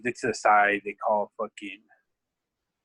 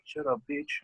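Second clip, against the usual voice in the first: high, tossed-off sneer; retold insult, lightly voiced; mock dismissive insult; 6 dB quieter than usual. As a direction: imitate a rude brush-off. Opposing coach insults theirs.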